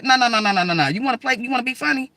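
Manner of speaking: mock challenging her to a fight; heated retort. To the girl on her couch, heated loud challenge. Confronting the other woman.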